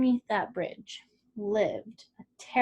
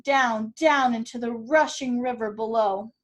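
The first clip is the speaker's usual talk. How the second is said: usual speaking voice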